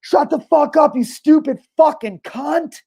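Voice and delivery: high, drawn-out mock scream of fury; imitated angry screaming. Retelling a lady yelling from the back, screaming in anger. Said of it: "screaming in anger".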